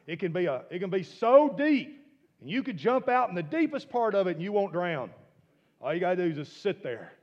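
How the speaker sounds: loud, high preaching at full force; fervent preaching shout. Real, not acted. Preacher shouting about the Dead Sea, full force. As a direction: preach it out over the congregation.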